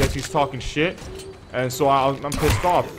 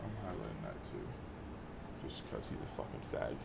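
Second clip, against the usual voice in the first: whispered, much softer aside; whispering; 13 semitones lower, 19 dB quieter than usual. Real, not acted. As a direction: whisper it under your breath. Muttering an aside off-mic.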